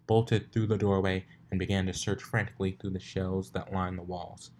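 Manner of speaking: usual speaking voice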